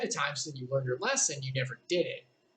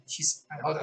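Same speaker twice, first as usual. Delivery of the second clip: plain reading voice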